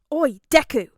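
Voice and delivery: gruff loud shout of a name; loud call. A narrator's reading. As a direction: bark his name across the room. Katsuki calls out rudely.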